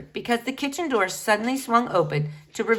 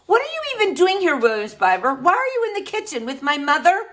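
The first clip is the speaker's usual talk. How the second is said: put-on accusing girl; 10 semitones higher, 5 dB louder, faster than usual. Flora accuses William.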